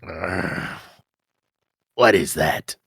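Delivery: low, grumbling monster voice; deep lizard growl. Acted.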